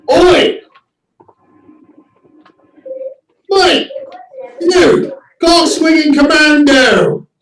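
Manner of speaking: Australian firefighter yelling out to an American in line, in a mock Aussie accent; mock Aussie shout. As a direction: hail him Aussie style, Oi Mate. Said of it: "he says the famous Aussie greeting".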